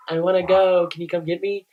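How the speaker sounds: high, loud plea for rescue; calling to friends. Retold or imitated. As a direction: call out to friends across a club. Calls over to friends for rescue.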